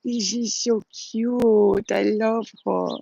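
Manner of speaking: slow, doting coo over the dog; drawn-out adoring pet voice; gushing baby talk. Retold or imitated. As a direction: stretch each word out like talking to a baby. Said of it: "and I'm like".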